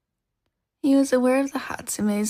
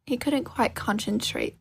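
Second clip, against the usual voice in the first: usual speaking voice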